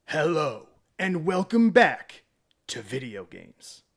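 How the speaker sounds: lightly voiced YouTuber intro, loud and slow, then dropping; a hint of a slow, booming video intro; Tim impression. Streamer imitates Tim's video opening, lightly voiced announcer. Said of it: "should I say that like Tim".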